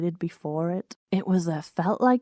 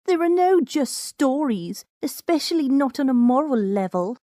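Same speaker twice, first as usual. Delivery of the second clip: higher know-it-all mare voice, quipping; higher smug quip from the mare; 7 semitones higher, 5 dB louder than usual. Acted. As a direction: play the clever bespectacled mare scoring a point. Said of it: "quipped the stocky mare".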